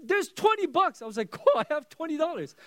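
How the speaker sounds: high, bright glee at finding cash; light up at the twenty bucks; excited discovery. Retold or imitated. Dad finding twenty dollars for his son, high delighted glee.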